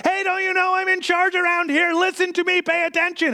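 high, exasperated parent demanding attention; frustrated parent. Retold or imitated. Parent to an ignoring child, high and exasperated. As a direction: insist on being heard, going nuts. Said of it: "And you go nuts".

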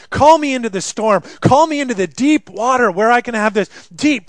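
Peter pleading. Acted. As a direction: cry out like Peter begging to be called. Preacher voices Peter's pleading prayer.

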